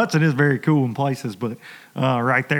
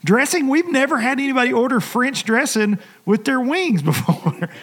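puzzled waitress; 8 semitones higher than usual. Retold or imitated. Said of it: "She goes".

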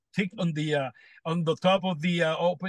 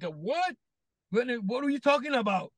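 retold bewilderment; 6 semitones higher than usual. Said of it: "And he's like".

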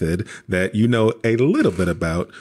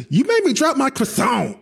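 much higher, hammed-up French accent. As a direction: whine it like the upset Frenchman in the viral video. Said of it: "Every time I hear a French accent".

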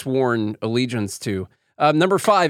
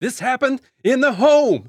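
much higher and louder, a squeaky parody voice; mock impression; 13 semitones higher, 5 dB louder than usual. Co-host mocking the failed Denzel impression, high and loud. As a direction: squeak it out like a cartoon send-up.

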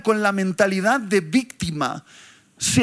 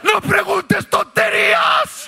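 scornful shout; 9 semitones higher, 4 dB louder than usual. Real, not acted. Scornful outburst.

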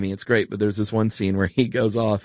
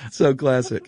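laughing delight; 5 semitones higher, 3 dB louder, slower than usual. Real, not acted. Laughing after movie quote.

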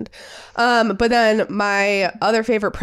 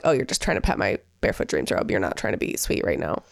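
mock accusing; 11 semitones lower, 5 dB quieter than usual. Catching husband petting her robe.

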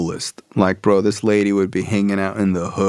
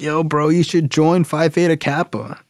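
eager friend pitch; 7 semitones higher than usual. Retold or imitated. Friend urges him to join.

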